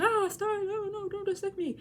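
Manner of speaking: high, panicky voice of a scared man; imitating Spirit. Retelling the manga, Spirit begging Stein in a high panicked voice. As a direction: squeal it like a man afraid of being dissected. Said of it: "and then he's like".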